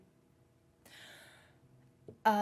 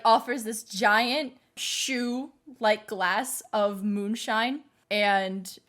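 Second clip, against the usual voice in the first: ordinary talk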